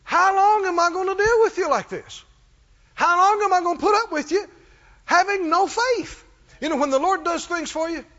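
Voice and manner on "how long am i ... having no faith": irritated rebuke. Acted. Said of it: "It was irritating him".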